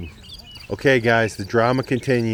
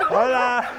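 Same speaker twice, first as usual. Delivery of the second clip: called out greeting; 12 semitones higher than usual. Real, not acted. Calling out to passing locals.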